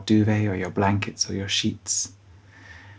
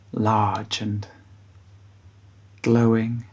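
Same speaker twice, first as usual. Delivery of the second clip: guided bedtime meditation. Acted. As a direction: guide children gently towards sleep, unhurried.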